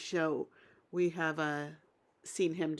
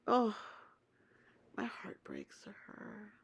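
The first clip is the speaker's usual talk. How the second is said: whispered, much softer, heart going out; heartbroken whisper; 2 semitones higher, 7 dB quieter than usual. Real, not acted. Watching Katina cry, a soft heartbroken whisper. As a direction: breathe it out, heartbroken for her. Sad over Katina crying.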